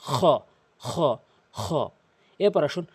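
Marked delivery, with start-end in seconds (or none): 0.1-1.6 খ as a scraping fricative from the top of the throat; back-throat kh. Shown as a demonstration. Teacher showing where the letter exits, scraped খ. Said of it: "হলকের শেষ থেকে উচ্চারণ করবেন".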